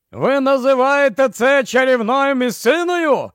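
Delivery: high-pitched incredulous yell; yelling over surf. A narrator's reading. Barney shouts at the professor over the surf, high-pitched yell. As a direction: call out over roaring waves. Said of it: "зарепетував Барні, намагаючись перекричати гуркіт прибою".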